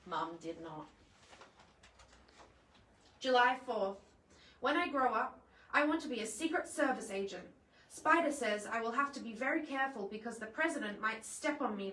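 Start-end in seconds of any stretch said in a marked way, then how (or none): none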